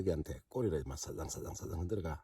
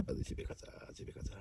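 whisper; 8 dB quieter than usual. Real, not acted. Cold, wants home.

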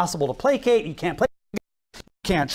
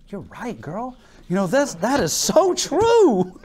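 mock swooning agreement; 4 semitones higher, slower than usual. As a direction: play a man completely won over, ending near laughter. Acts David charmed by Abigail's appeal.

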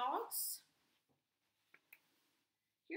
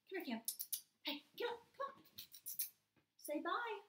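higher, sing-song pet talk; coaxing the dog; 7 semitones higher, 3 dB quieter than usual. Real, not acted. Teacher calling her dog Cam over, high coaxing pet voice. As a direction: coax the dog to come over sweetly.

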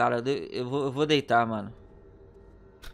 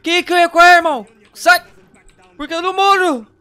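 panicked shout; 5 semitones higher, 7 dB louder than usual. Real, not acted. Fleeing attacking dog.

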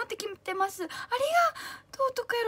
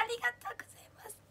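whispered thanks; 6 semitones higher, 11 dB quieter, faster than usual. Real, not acted. Thanking a fan in chat.

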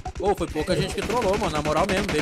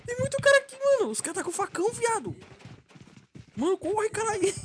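very high frantic yelling; panicking. Real, not acted. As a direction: shriek while running away. Horde with machetes chasing.

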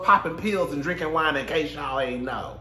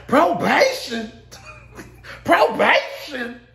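high, loud, slow disbelief; incredulous; 10 semitones higher, 6 dB louder, much slower than usual. Retold or imitated. Kandi questioning Drew's probation plan, high and slow in disbelief. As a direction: drag out the disbelief. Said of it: "Kandi was like".

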